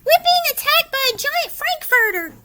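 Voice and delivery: high, loud frightened cry; panicked cats. Acted. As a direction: shout in alarm like a cartoon cat. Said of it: "cried the cats".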